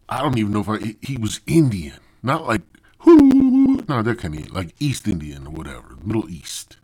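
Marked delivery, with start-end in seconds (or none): 3.0-3.8 high, loud, sustained "hoo"; mock whoop. Comic clarifying which Indian he means, mock whoop. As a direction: let out one long mock whooping cry.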